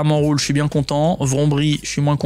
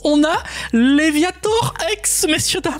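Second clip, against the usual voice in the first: much higher, slow, drawn-out hype; thrilled announcement; 12 semitones higher, slower than usual. Real, not acted. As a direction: present it like a trophy. Legendary card pulled.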